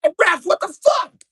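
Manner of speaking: high, loud furious yell; angry dad screaming at the ref. Retold or imitated. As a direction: tear into the referee. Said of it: "starts yelling at the ref".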